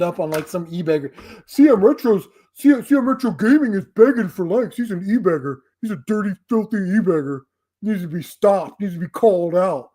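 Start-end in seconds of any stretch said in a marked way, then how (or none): 1.5-9.9 mock hater voice. Mocking an imagined viewer calling him an e-beggar, whiny sneer. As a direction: sneer it like a self-righteous commenter.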